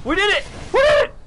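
high, loud cheer breaking into laughter; triumphant shout. Real, not acted. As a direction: shout in gleeful victory. Lands on the ship.

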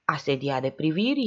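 usual speaking voice

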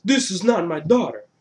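loud, high mock outburst; put-on indignant father's announcement; imitated boss. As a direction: declare it like a cartoon boss. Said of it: "then he comes out and says".

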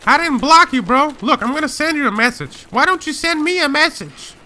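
high-pitched defensive pleading; high, incredulous denial; indignant protest. Real, not acted. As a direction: protest your innocence, voice pushed up high. Protests an accusation of blocking.

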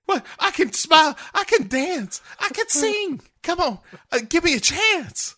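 very high, frantic put-on starlet. Starlet begging for a chance to perform, mock desperate. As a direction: squeal each plea, hammed-up and desperate. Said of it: "[name] impression turned into [name]".

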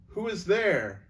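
calling out. A narrator's reading. Erebus calls through the gate.

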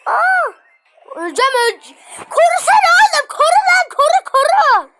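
high, loud panicked yelling; desperate scream for help; panicked shout. Real, not acted. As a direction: yell for cover as you're about to die. About to die, yelling for cover.

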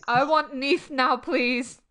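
usual speaking voice

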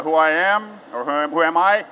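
ordinary delivery

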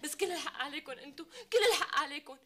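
tearful wailing, laughing through sobs; crying. Acted. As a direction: sob out the complaint in despair. Girl sobbing about forced marriage.

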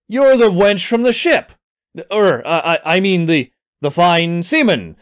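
excited blurt, then stumbling flattery; flustered backpedaling. Acted. Ryan insults the pirate woman then backpedals, flustered and halting. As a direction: stumble over yourself correcting a rude slip.

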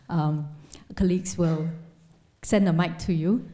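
ordinary talk

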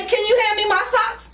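high, whiny imitation of girlfriend; imitating girlfriend. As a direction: mimic her asking sweetly. Imitating partner's request.

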